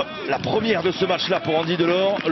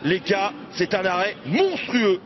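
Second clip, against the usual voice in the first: high, shouted save call; excited sports commentary; 9 semitones higher, slower than usual. Acted. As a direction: roar out the keeper's save. Commentator calls a big save.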